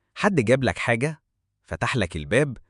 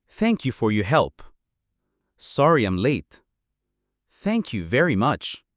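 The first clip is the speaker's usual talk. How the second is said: very slow, clear "Thank you very much"; slow example read; 2 semitones lower, much slower than usual. Shown as a demonstration. Teacher reading examples very slowly for learners. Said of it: "أمثلة".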